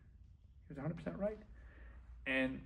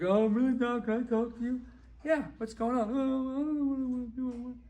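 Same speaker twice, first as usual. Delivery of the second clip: higher-pitched mock dithering; floundering client; 7 semitones higher, 4 dB louder than usual. Client unsure what he wants asks doc for a word, mock dithering. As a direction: play up the lost, dithering client.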